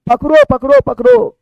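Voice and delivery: high, loud repeated cry to catch someone; urgent call. Acted. Teacher acting out a chase for students, urgent call. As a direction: call out sharply, pitch leaping up.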